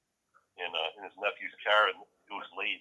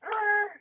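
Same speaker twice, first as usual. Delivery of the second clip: mock yell. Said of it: "They're like".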